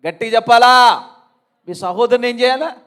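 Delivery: loud, high fiery preaching; high-pitched shouted sermon calls; preaching at full force. Acted. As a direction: rouse the congregation with loud calls.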